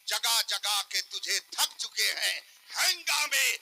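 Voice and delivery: high, loud recitation of verse; impassioned preaching. Real, not acted. Preacher exhorts the gathering with verse, impassioned. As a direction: declaim the couplets at full force.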